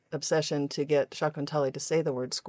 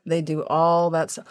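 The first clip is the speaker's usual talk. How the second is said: ordinary talk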